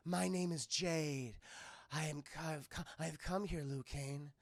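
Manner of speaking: slow, low, soft sultry voice, trailing to a whisper; seductive Jade. Acted. Jade seducing Liu Kang, slow low sultry murmur. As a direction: purr it slowly and quietly.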